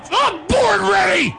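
put-on very high, drawn-out boyish shout; squeaky overconfidence. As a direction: proclaim it in a confident falsetto. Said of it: "putting in a man with confidence".

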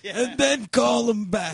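put-on old man voice.

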